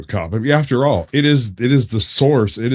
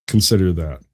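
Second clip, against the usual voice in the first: usual speaking voice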